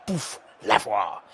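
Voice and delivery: puffed "poof", slow mock-French "le voir"; Zuma kicking the ball. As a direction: imitate a French footballer striking the ball. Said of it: "do you think Zuma goes".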